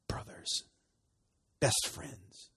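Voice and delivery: hushed breathy whisper. Real, not acted. Preacher to congregation about covenant love, whispering. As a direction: drop to a whisper for intimacy.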